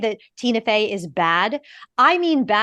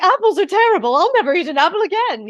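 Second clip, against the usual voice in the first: put-on much higher theatrical old-lady voice, a little fast; dramatic dotty novelist vowing off apples; Hugh Fraser's Ariadne; 8 semitones higher, 4 dB louder, faster than usual. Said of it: "I'm doing the voice that Hugh Fraser does".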